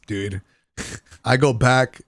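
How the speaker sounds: ordinary talk, nothing marked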